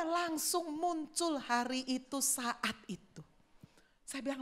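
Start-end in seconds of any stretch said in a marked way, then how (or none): none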